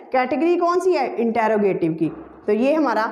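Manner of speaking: ordinary talk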